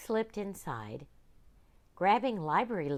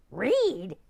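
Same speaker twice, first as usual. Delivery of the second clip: startled pirate; 6 semitones higher, 9 dB louder than usual. Acted. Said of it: "cried the captain".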